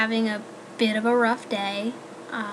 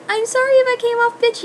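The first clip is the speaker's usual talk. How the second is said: much higher, louder, quick apologetic gush; imitating her friend; 11 semitones higher, 6 dB louder, faster than usual. Quoting Katie's apology, a high, fast, louder gushing voice. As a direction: rattle off a sweet squeaky apology. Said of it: "And she's like".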